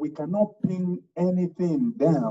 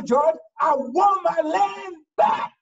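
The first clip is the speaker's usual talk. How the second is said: insistent widow; 11 semitones higher, 4 dB louder, slower than usual. Acted.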